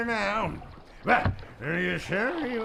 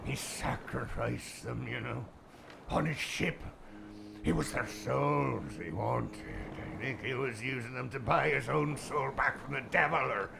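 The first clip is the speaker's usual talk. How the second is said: softer, lower spooky telling; hushed tale; 6 semitones lower, 5 dB quieter than usual. Acted. Boatman telling of Red Eye, hushed. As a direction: drop to a hush for the legend. Ghost story told in hushed tone.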